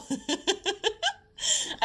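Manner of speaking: high giggle rising; laughing. Real, not acted. Vlogger giggling at the end of her pep talk, high laugh. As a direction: giggle warmly.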